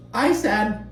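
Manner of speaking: high, put-on voice of someone mid-chew; mock mouthful. Vlogger mimics Tamara caught chewing, mouth-full voice. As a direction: imitate her answering with food in her mouth.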